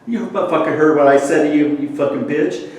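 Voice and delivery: loud, high mock-angry snarl; imitating a furious man; angry retold threat. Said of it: "really pissed off at me".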